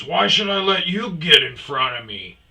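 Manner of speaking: indignant retort. Retold or imitated. Other driver argues back.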